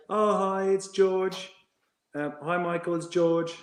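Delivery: high-pitched, polite phone greeting; lightly mocking high, meek voice; young player's voice. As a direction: imitate an academy player greeting the coach. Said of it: "And he's like".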